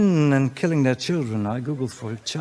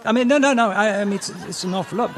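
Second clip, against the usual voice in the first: normal talking voice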